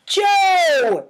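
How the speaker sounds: high, loud call of the dog's name. A narrator's reading. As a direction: call the dog sharply. Amy scolding her dog.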